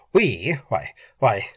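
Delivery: halting, flustered false starts; losing self-possession, faltering; stammering. A narrator's reading. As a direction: stumble over the first words, alarmed. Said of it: "Helberson stammered".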